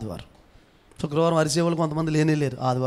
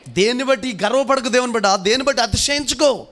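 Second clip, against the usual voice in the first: high, loud preaching; forceful exhortation; 7 semitones higher, 4 dB louder than usual. Real, not acted. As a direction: drive the warning home to the crowd. Preacher exhorting at raised pitch. (గట్టిగా హెచ్చరించే ప్రసంగ స్వరం)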